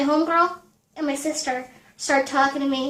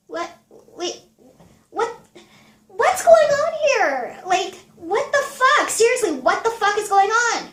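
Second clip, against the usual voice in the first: shocked disbelief; 7 semitones higher than usual. Retold or imitated. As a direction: climb into stunned half-laughing outrage. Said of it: "I was like".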